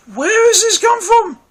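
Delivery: like nothing makes sense anymore; incredulous. Retold or imitated. Viewer reacting to the queen's turnaround, incredulous and high. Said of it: "And you're like".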